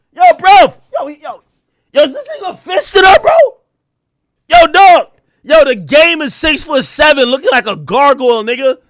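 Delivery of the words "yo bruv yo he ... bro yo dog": loud, higher cries of disbelief; stunned outcry. Retold or imitated. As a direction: yell it like you just saw something crazy. Reacting in shock to the scene.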